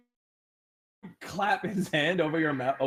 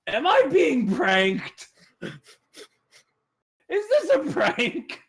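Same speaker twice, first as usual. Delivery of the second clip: slow, rising, much higher bewilderment; confused lizard guard; 5 semitones higher, 3 dB louder, slower than usual. Acted. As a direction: puzzle it out loud, slower and slower. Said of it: "looks a little confused and goes".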